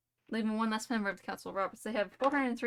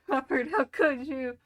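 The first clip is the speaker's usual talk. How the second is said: dramatic cry; 4 semitones higher, 7 dB louder, slower than usual. Acted. Dramatic betrayal cry in story.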